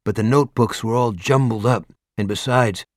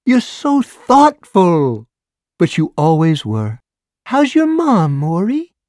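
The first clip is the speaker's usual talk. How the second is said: high, slow, cooing busybody; gushing neighbor; 9 semitones higher, 6 dB louder, slower than usual. A narrator's reading. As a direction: flatter him shamelessly. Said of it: "monkey shriek of laughter".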